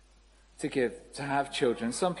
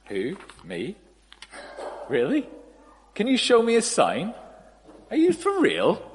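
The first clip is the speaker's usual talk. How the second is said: disbelieving Zechariah, rising; 4 semitones higher, 4 dB louder than usual. Retold or imitated. Zechariah answering the angel, growing incredulous. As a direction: build from a small doubtful who to an incredulous for real. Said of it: "His reaction to this is like".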